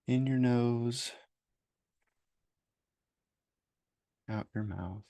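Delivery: slow meditation voice with long pauses; guided meditation. Acted. Therapist leading listeners to name self-judgments, slow guided meditation. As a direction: guide them unhurriedly, leaving space after each phrase.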